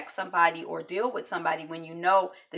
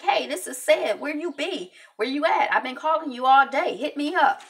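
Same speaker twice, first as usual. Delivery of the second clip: higher and louder, easygoing caller; casual voicemail; 8 semitones higher, 4 dB louder than usual. Retold or imitated. Cedric calls Brownwin, a higher breezy voicemail.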